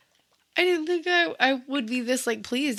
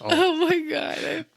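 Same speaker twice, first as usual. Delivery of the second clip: laughing; 6 semitones higher, much slower than usual. Real, not acted. Laughing at the bit.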